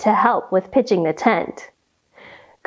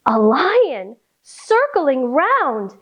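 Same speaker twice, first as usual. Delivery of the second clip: plain reading voice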